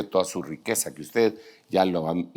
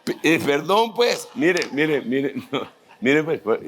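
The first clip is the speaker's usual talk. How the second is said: higher, broken up by chuckles; laughing apology; 5 semitones higher, slower than usual. Real, not acted. Preacher apologizing after his own joke, laughing. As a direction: apologize while trying to stop laughing.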